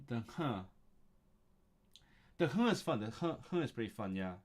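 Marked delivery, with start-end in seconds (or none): none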